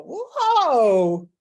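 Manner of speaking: loud, high yelp as if thrown by a horse; a mock rodeo cry; bronco rider whoa. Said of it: "riding that bucking bronco".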